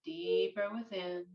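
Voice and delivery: unhurried, hushed breathwork narration; guided meditation. Acted. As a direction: lead them gently into stillness, phrase by phrase.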